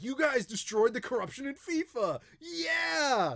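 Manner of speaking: high shouted cheer, voice cracking up; cheering Europeans. Retold or imitated. Mimicking Europeans cheering the US over FIFA, loud, high and whooping. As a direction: whoop it like a crowd celebrating a victory. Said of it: "They were like".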